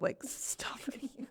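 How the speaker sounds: soft whispered discovery; whispering about bits in the cup; whispered aside. Retold or imitated. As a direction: drop to a whisper as you peer in. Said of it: "You're like".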